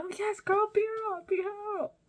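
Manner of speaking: very high-pitched imitated cheering friend; egging on. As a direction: squeal like a friend cheering on a fight. Acting out friend cheering on fight.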